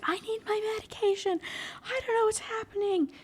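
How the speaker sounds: very high, strained mock scream. Book character waking confused in the kitchen, mock screaming. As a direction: squeal it in panic without real volume. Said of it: "you know, screaming".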